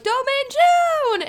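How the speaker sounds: high, slow, stretched call of a name; desperate yell. Retold or imitated. Heroine at the cliff edge calls for help, a long high yell. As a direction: cry out his name as the car nears the cliff. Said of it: "she just yells at the last minute".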